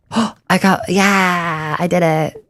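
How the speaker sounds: high, loud burst of joy; excited shout. Real, not acted. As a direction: cheer it out, triumphant. Escaping the cave at last.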